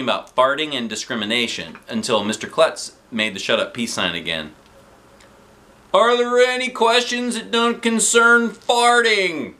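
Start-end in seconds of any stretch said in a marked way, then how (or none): 5.9-9.5 high, slow, loud and fed up; exasperated principal. A narrator's reading. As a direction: sigh through a weary question.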